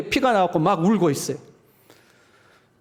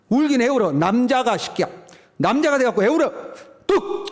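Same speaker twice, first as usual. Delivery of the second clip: higher, sharp mock scolding; scolding father; 6 semitones higher than usual. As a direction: imitate a gruff dad telling his son to stop crying. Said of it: "대부분의 아버지들이 뭐라고 그러는데".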